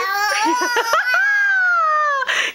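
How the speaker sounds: high laughing half-cry; joyful laugh. Real, not acted. Mother laughing as baby walks. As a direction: laugh out with joy.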